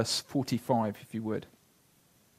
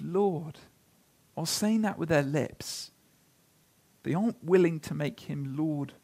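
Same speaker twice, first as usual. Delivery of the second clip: ordinary talk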